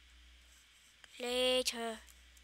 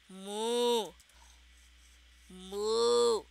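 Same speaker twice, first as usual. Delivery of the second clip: mock moo. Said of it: "I'll type it in".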